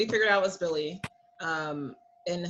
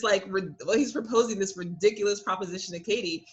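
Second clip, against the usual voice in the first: normal talking voice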